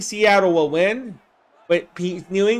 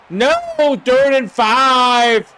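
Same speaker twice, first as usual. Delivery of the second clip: sudden shout, dragged out slowly; excited call; 4 semitones higher, 4 dB louder, much slower than usual. Real, not acted. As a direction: yell the down like a surprised commentator. Calling a live football play.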